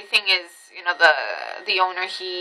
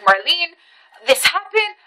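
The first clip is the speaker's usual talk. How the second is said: pleading phone call; 8 semitones higher than usual. Retold or imitated. Retold panicked phone call to coworker.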